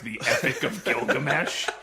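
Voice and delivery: usual speaking voice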